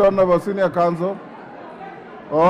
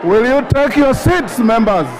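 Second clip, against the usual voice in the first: raised, high, insistent calls over the noise; calling for order; 6 semitones higher than usual. Real, not acted. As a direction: keep hammering for order above the din. Speaker calling rowdy House to order.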